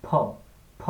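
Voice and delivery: "ফ" as a popped p-h, burst of air; "ফ" with lips shut then puffed open; aspirated P. Shown as a demonstration. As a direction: model "ফ" between প and ফ. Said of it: "একটা বাতাস বের হবে ধুম করে".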